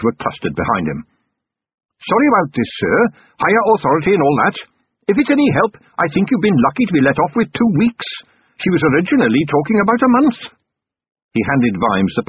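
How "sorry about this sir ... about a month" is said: higher, eager captain voice; polite, earnest young officer. Acted. Narrator voices Carrot.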